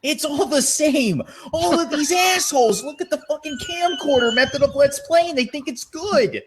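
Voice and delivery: hammed-up furious youtuber shouting; mock rant. Imitating a youtuber flipping out at other let's players. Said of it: "he starts flipping out".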